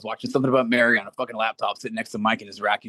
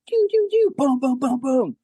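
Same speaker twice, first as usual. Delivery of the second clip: high-pitched mock whistles and booms; sound-effect mortar barrage; imitated mortar fire; 3 dB louder, slower than usual. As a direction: mimic shells launching and exploding in a rising-voiced rattle. Imitating mortars in a war story.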